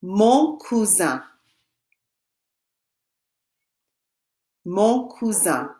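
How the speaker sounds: slow, drawn-out French demonstration; slow French model. Shown as a demonstration. Teacher models the French for students to repeat, slowly. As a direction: say it very slowly for learners. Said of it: "and I will say it".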